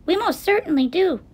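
high, emphatic tiny-person voice; piping little voice. A narrator's reading. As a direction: pipe up with certainty. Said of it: "piped the voice".

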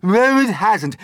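high, loud denial; shouted contradiction. A narrator's reading. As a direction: shout back in disagreement. Aunts bickering loudly about the peach.

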